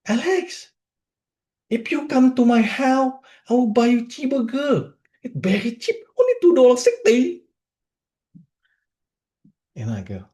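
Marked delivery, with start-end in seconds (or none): 0.1-7.4 put-on very thick Vietnamese accent, very high-pitched; Long. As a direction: imitate a Vietnamese friend pitching a sale, high voice. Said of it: "in this very thick Vietnamese accent".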